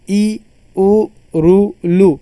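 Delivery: ई, उ, रु, लु each said short and quick; short vowel demo. Shown as a demonstration. Teacher listing the short vowels aloud for students. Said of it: "ये ऐसे स्वर है कि इनकी जो अदायगी".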